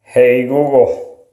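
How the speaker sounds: usual speaking voice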